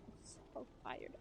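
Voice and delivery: a sleepy whisper, barely voiced; tired whisper. Real, not acted. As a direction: breathe it out in a slow whisper. Whispered repeat of being tired.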